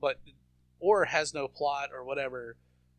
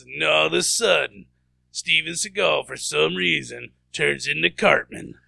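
Space Ice guy; 9 dB louder than usual. Retold or imitated. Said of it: "the Space Ice guy goes, he goes".

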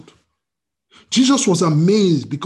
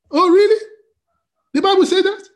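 high, loud incredulous questions; mock disbelief; 13 semitones higher, 6 dB louder than usual. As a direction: act astounded by what was just read. Acts astonished at the verse.

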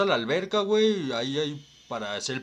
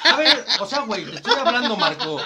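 mock vlogger; 8 semitones higher, 6 dB louder than usual. Mock interview bit continues.

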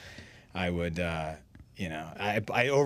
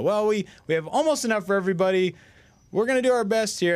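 high, loud emcee backpedaling; imitating the emcee hyping the crowd; MC hype voice; 13 semitones higher, 7 dB louder than usual. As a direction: cover for him like a ring announcer. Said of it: "he would go".

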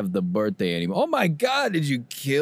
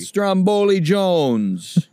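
Stromboli Jones stretched very slow and higher; drawn-out name; 8 semitones higher, 4 dB louder, much slower than usual. Pretend. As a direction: announce it like a showman.

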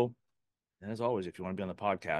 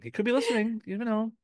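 much higher, playful squeak; high-pitched playful voice; 14 semitones higher, 5 dB louder than usual. Pretend. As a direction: repeat it in a silly high voice.

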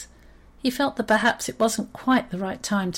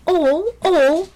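high, loud indignant mouse; protesting; 9 semitones higher, 7 dB louder than usual. Acted. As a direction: protest in a squeaky, affronted voice. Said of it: "protested Horatio".

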